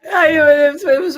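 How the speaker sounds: high, loud burst of laughter; laughing. Real, not acted. Laughing at co-host's plaid joke.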